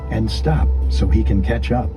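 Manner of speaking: ordinary delivery, nothing marked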